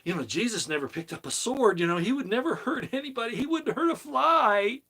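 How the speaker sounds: high, mocking sing-song impression of critics. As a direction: sneer at them in a high, silly voice. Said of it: "People say".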